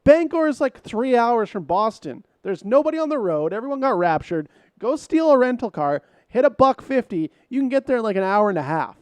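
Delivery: loud disbelieving rant to co-host; exasperated rant. Real, not acted. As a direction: blast the plot hole in disbelief. Exasperated rant about driving.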